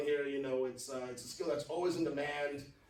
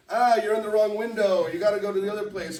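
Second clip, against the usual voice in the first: louder, higher retold clerk waving you off; mock clerk brush-off; 5 semitones higher, 11 dB louder than usual. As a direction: play the impatient clerk at the window. Clerk sending you elsewhere.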